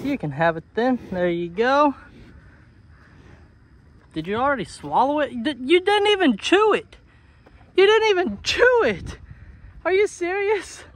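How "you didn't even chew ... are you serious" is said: high-pitched disbelief; incredulous. Real, not acted. Owner to animal that gulped the treat, incredulous. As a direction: scold in astonished, high-pitched protest.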